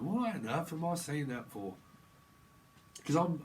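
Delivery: ordinary delivery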